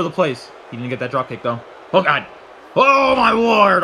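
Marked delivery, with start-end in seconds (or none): 1.9-3.8 loud, high, long drawn-out cry; awed commentator shout; shocked exclamation. Real, not acted. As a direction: stretch it out in amazement. Shocked reaction to toss.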